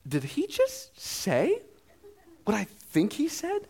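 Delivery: high, slow, incredulous inner voice; can't believe what he just heard; disbelief. Retold or imitated. Dad hearing his toddler say play, slow stunned disbelief.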